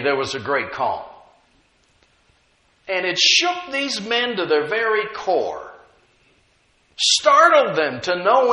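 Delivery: high, loud pulpit proclamation; full-force preaching. Real, not acted. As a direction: preach it out high and emphatic, phrase by phrase. Preaches the storm story with force.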